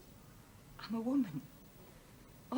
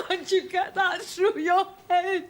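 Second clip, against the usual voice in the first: tearful laugh; 6 semitones higher, 6 dB louder, slower than usual. Acted. Wife crying, half laughing.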